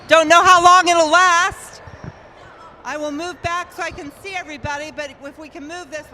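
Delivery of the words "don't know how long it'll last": projecting loudly to the whole crowd; big, carrying voice without a mic; loud outdoor voice. Real, not acted. Host projecting to the crowd at a wheelchair tournament, loud. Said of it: "I am going to use my water voice".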